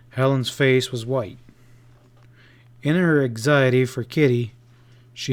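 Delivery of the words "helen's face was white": plain reading voice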